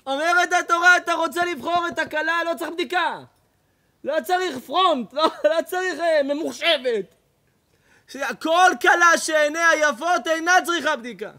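high, loud, driving sermon voice; full-force rabbi's exhortation; fiery preaching. Acted. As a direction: preach it hard, almost shouting.

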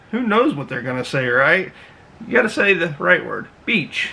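ordinary delivery

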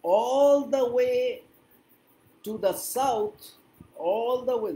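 "All the way" drawn out slowly and high; stretched emphasis. Shown as a demonstration. Teacher models phrase, stretching it. ("All the way" টেনে, উঁচু সুরে)

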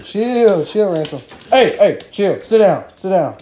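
loud, sharp commands to a jumping dog; loud real commands to sit; firm dog commands. Real, not acted. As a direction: order the dog to settle, loud.

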